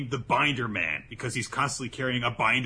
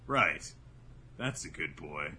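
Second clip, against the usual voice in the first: low, soft and patronizing; condescending; 9 semitones lower, 5 dB quieter, slower than usual. Retold or imitated. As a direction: pat him on the head with your voice. Rindo voiced condescendingly.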